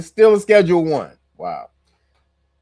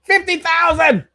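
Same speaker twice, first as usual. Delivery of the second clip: high, slow mock boast; mocking her rant; 10 semitones higher, slower than usual. Streamer mocking Bondi's stock brag, high and slow. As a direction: stretch it out like a gloating brag. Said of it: "she goes".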